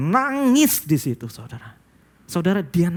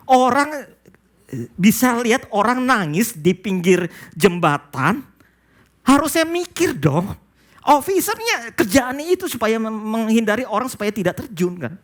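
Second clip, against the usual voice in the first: indignant preaching; 9 semitones higher than usual. Real, not acted. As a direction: raise your voice at their negligence. Preacher rebukes negligent officers.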